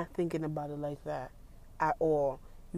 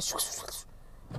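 whispered shoo noise; 9 dB quieter than usual. Retold or imitated. Imitating the scraping sound.

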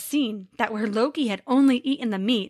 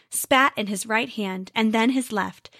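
ordinary delivery

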